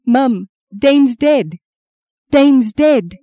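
high, loud, slow cry of grief; anguished. Acted. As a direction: break terrible news in anguish. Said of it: "sounded like a gasp, or a sob".

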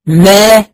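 ما with lips parting into an open, rising a; touch the lips, then open the jaw wide on ما; ما pronounced with the lips touching, then the jaw dropping open; open meem demo. Shown as a demonstration. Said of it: "يبقى بيحصل تباعد".

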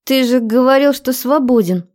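с обидой, выше; обиженный упрёк; обиженно. A narrator's reading. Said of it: "с досадой цыкнула и обиженно надула губы". (обиженно, с досадой)

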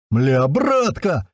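slow, stretched joyful cry; overjoyed exclamation. A narrator's reading. Strike hears the street and cheers up, overjoyed. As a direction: draw it out in delighted disbelief. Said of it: "ещё больше развеселился Страйк".

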